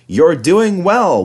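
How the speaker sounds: high, slow encouragement; encouraging. A narrator's reading. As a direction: cheer him up the hill. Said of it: "whistled Edward".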